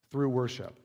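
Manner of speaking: hushed emphatic repeat; quiet echo. Shown as a demonstration. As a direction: let the phrase sink in softly.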